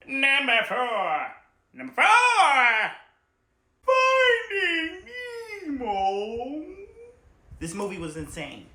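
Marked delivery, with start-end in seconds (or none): 0.1-2.9 slow, loud, high wail; mock-sob announcement; wailing countdown. Drawn countdown. 3.9-6.6 high crying shout; mock-sob title; wailed title reveal.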